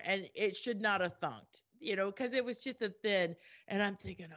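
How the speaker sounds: ordinary talk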